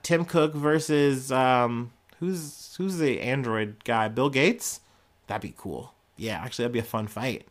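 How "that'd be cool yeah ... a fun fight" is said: much softer, low aside; quiet musing. Real, not acted. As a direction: muse to yourself.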